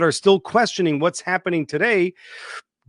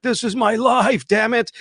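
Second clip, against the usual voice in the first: mock die-hard sports fan; 7 semitones higher than usual. Mocking stat-obsessed football fans, hammed-up devotion. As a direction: mimic a fan who lives for the game. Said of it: "they're like".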